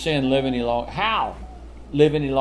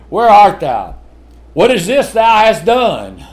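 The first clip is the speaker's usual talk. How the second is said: loud, higher stern parent call; booming call; 4 semitones higher, 8 dB louder than usual. Retold or imitated. Parent calling child to account, loud and stern. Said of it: "That's what you say to the kids".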